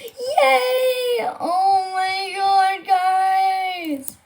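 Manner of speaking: high, drawn-out happy squeal; excited squeal. Real, not acted. Streamer celebrates getting an ostrich, excited squeal. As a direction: celebrate with a long high wail of delight.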